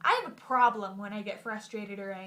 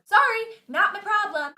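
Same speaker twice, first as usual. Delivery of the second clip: higher and louder brush-off; dismissive clerk; 9 semitones higher, 9 dB louder than usual. Retold or imitated. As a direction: shrug her off, snippy. Quarrel with DMV clerk.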